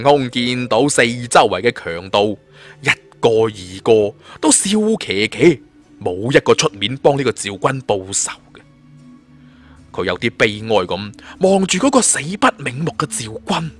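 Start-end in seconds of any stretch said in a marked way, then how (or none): none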